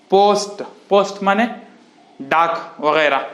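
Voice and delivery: ordinary delivery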